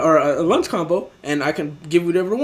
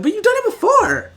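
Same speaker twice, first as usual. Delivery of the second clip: high squeaky imitation of the customer; mock customer voice; 4 dB louder than usual. Worker mimics the customer's complaint, high and whiny. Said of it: "And he's like".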